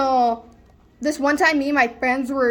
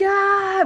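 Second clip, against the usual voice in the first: high acted cry; short panicked yelp, pitched up; mock scream; 8 semitones higher than usual. Kid answering the cops, with a mock scream.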